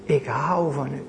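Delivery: soft, inward confession of love; hushed, tender words to the Lord; heartfelt quiet prayer. Retold or imitated. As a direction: pray it gently from the heart. Vanuit het diepst van je hart kan zeggen.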